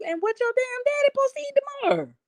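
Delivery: high, fast, mock-indignant squeal; acting out her comeback to her son in a high, fast, indignant voice; mock outrage. Mom snapping at son over the ribs, high and fast. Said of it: "I'm like".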